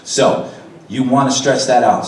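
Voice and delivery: usual speaking voice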